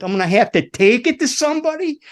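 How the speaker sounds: high, helpless mock whine; retold panic. Sweating tech admitting he needs somebody else, mock panic.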